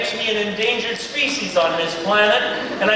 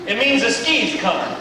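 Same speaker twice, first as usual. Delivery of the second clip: usual speaking voice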